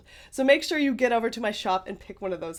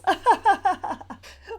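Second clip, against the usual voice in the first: witch cackle; 10 semitones higher, 5 dB louder than usual. Acted. Cackle after witch threat.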